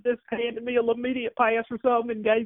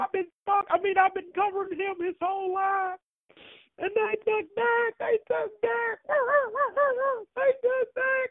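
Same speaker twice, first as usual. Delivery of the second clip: hammed-up sobbing; 8 semitones higher than usual. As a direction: blubber the same line again and again. Caller sobbing over Dak.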